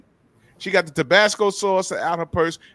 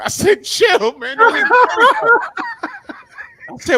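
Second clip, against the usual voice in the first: laughing; 10 dB louder than usual. Real, not acted. Cracking up while retelling.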